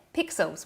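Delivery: Pexels with its E said like an I, as pixels; New Zealand accent. Real, not acted. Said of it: "sounds like I'm saying pixels in my New Zealand accent".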